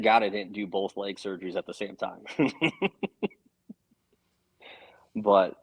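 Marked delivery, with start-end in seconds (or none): 2.3-3.3 real laugh. Real, not acted. Laughs at his own remark.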